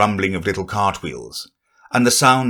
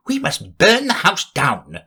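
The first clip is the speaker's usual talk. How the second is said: rabbit voice; 8 semitones higher than usual. Acted. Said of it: "said the rabbit's voice".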